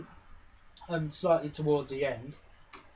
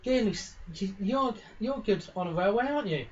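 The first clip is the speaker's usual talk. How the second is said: higher, hopeful little pony voice; eager filly asking; 8 semitones higher than usual. Acted. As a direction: coax him into fixing it. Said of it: "he just goes".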